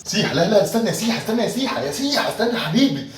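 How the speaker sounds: high loud pleading call; calling after. Acted. Boss yelling after the departing employee to wait. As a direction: shout after him to wait.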